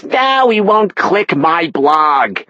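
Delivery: high, slow sulky whine; whining mother. A narrator's reading. Mother sulks her son won't read her blog, high whine. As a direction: pout it out slowly. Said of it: "she whined".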